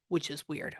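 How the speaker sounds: usual speaking voice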